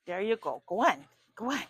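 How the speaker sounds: loud, encouraging calls to the dog; urging the dog. Real, not acted. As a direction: cheer the dog up the stairs.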